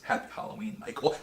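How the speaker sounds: whispered movie one-liner; soft, breath-only acted taunt; hushed menace. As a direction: whisper it right behind the killer. Acting out the heroine's reveal line.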